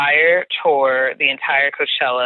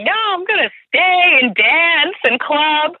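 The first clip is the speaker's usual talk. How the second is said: put-on high, slow, drawn-out party-girl protest; tipsy insistence; 11 semitones higher, slower than usual. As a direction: act out your drunk self refusing to leave. Acts out herself drunk, insisting.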